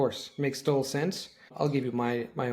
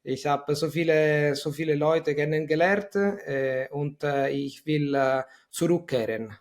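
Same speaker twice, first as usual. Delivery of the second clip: put-on Italian accent; 3 semitones higher, 5 dB louder, slower than usual. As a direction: imitate an Italian speaking German.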